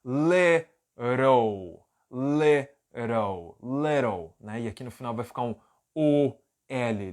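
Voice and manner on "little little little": "Little" with the T's said as a tapped R, "lirow"; American flap T. Shown as a demonstration. Teacher modelling American "little" with a flapped T. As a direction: say "Little" like "lirow", the T a quick tap. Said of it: "Esses dois T's vão ter som de R".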